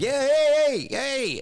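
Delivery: loud, very high holler; acted shout for attention; calling out. Customer stopping a man taking his dryer, loud high holler.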